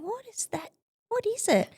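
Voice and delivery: startled puzzlement. Retold or imitated. Peering down at the runway.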